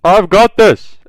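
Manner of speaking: mock heroic cry; confident hero shout. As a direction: announce it like a hero stepping in. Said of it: "And he's like".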